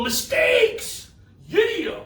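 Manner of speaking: very high, celebratory whoop; high-pitched, gleeful shout-out; jubilant cheer. Shown as a demonstration. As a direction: cheer it like a win for kids. Leads kids in a cheer.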